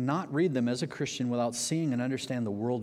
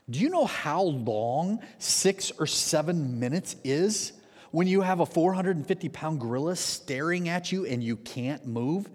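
usual speaking voice

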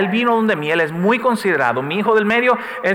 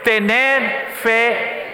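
very slow, "Tener fe" stretched out; a drawn-out call and response; slow call to repeat; 3 semitones higher, much slower than usual. Shown as a demonstration. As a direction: lead the congregation to repeat it slowly. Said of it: "Puede decir esa frase conmigo".

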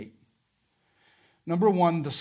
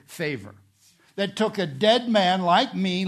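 loud, raised, driving sermon voice; impassioned preaching; 4 semitones higher, 7 dB louder than usual. Real, not acted. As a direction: proclaim it at full force to the congregation. Preaches at full force.

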